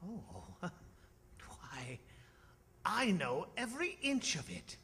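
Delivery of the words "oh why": whispering. Acted. Scrooge awed by familiar countryside.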